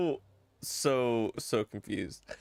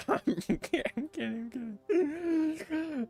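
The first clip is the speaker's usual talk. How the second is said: laughing; 8 semitones higher than usual. Real, not acted. Laughing through a joke.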